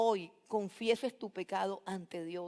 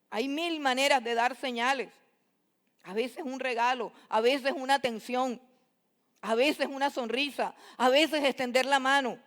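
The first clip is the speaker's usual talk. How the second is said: loud, pressing exhortation; preaching louder and higher with urgency; fervent preaching; 4 semitones higher, 7 dB louder than usual. Acted. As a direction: urge the listeners to give a sign. Pleading preaching run.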